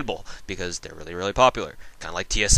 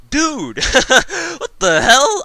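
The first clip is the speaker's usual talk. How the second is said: exasperated; 13 semitones higher, 10 dB louder, slower than usual. Retold or imitated. Mock outrage at the troll.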